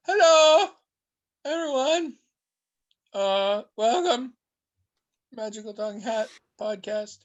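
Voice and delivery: hammed-up show opening; podcast host intro. Host opens the podcast, hammed-up announcer voice.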